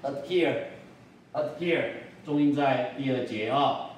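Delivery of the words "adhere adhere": "adhere" with a very short pause where the D would be; silent, held D in "adhere"; brief stop for D. Shown as a demonstration. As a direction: say "adhere" as "uh', here", D never released. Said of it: "很短暂的时间".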